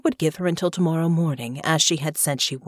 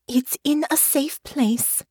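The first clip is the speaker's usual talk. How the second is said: put-on evasive young lady; 9 semitones higher, slower than usual. Honor voiced in the story.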